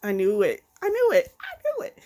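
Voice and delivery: climbing to a shrieking high "I knew it"; building from excited to shrill disbelief; rising excited scream. Retold or imitated. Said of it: "I was like".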